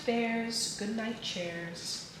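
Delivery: ordinary delivery, nothing marked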